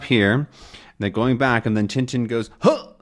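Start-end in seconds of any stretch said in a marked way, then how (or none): 2.6-2.9 short acted gasp of surprise; surprised gasp. Tintin coming upon something, surprised gasp. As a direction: gasp as if startled. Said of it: "He looks surprised".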